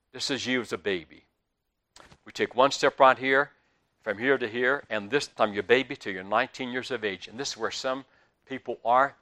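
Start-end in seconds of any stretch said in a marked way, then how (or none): none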